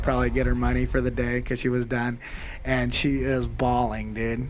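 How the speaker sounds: ordinary talk, nothing marked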